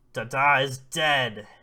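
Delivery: loud and slow, each word weighted; emphatic pronouncement. Acted. Oba answers Lulu about Dada, loud slow pronouncement. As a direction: deliver it as a heavy, forceful verdict.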